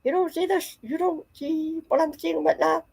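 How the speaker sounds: high, hushed pleading disbelief; a kid who can't believe nobody sees it; frustrated. Retold or imitated. Child frustrated that her mom can't see the man, high and pleading. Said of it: "I started getting frustrated".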